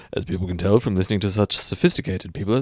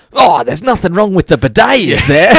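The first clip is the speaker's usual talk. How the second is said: higher, louder mock Aussie boss; mock Australian; 8 semitones higher, 6 dB louder than usual. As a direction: ham up the outback accent. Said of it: "he's like".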